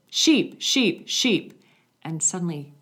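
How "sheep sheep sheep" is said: strong clear call. Retold or imitated. Shepherdess calling her sheep across the hillside. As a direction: call out high and loud. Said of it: "just with a strong, clear voice".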